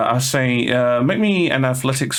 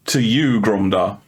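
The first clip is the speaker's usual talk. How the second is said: ordinary talk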